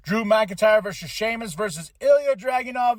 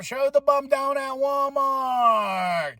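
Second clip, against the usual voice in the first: joking yell; 7 semitones higher, much slower than usual. Real, not acted. Shouted outro joke.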